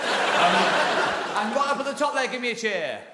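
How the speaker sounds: high, loud call to the top tier; calling to the crowd. Real, not acted. Comic to the top seats wanting a cheer, calling out.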